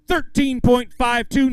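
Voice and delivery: slow, loud, high-pitched weight call; big announcement. Real, not acted. Emcee calling an angler's weight to the crowd, big announcement. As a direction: announce it to the whole crowd, drawn out.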